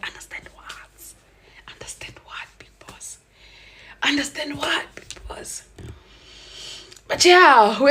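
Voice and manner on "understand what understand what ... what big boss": slow, breathy mocking whisper. Scornfully whispering back to the cheating boyfriend.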